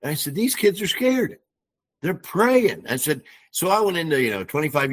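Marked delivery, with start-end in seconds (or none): 0.3-2.8 retold plea, pitched high and drawn out; impassioned plea. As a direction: plead for the kids in a raised voice. Retelling his impassioned plea.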